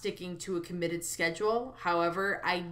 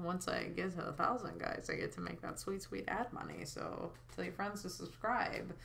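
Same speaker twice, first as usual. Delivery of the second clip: soft, tight, teeth clenched; through gritted teeth; 10 dB quieter than usual. Pretend. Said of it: "I say through gritted teeth".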